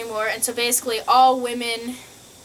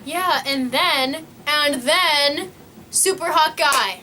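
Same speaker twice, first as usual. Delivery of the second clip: excited buildup; 5 semitones higher, 5 dB louder, slower than usual. Real, not acted. Building up hot guy.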